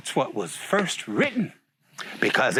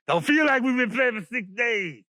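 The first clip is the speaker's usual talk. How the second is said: protesting exclamation; 6 semitones higher than usual. Acted. Animals answer the voice from above, high protest. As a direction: call it out high in disbelief.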